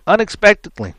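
ordinary talk, nothing marked

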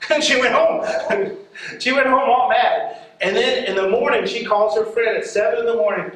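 usual speaking voice

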